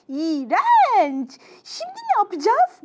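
very high exclamation; loud "yuck" leaping into high panic; disgusted alarm. Acted. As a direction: cry out in disgusted dismay. Parapella disgusted, alarmed by sticky jelly.

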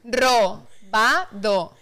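very slow, each syllable separated; slow, spaced-out dictation. Real, not acted. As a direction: stretch it out with gaps between syllables. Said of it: "dile palabra por palabra".

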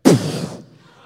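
sudden loud voiceless burst of breath; imitated falling-out puff. Storyteller mimicking a woman falling out, loud puff. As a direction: blow out a hard puff of air. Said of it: "She went".

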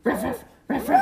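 very high squeaky barks; squeaky puppy voice. Acted. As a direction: yip like a puppy.

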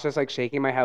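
usual speaking voice

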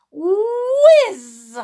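high, loud, stretched whiz; whizzing effect. A narrator's reading. Boy flying through the air, whizzing sound. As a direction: zoom through the word. Narrator voices flight.

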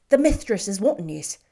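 put-on loud, urgent Irish maid calling the boy; excited nurse. A narrator's reading. Maid fetches the boy from the shop, loud and flustered. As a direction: hurry him home, flustered. Mary calls Cedric home.

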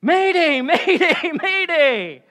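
high, very loud repeated shout; pilot's distress call. Acted. Pilot in trouble radioing for help, urgent shouting. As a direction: yell it like an emergency call from the cockpit. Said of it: "A pilot would say".

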